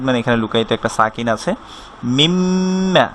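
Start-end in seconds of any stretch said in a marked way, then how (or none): 2.2-3.1 মিম্মা with the mm hummed; nasal ghunna. Shown as a demonstration. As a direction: hold the doubled m of মিম্মা in the nose. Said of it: "মিম তাসদিদ ওয়াজিব গুন্না".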